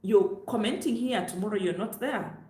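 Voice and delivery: usual speaking voice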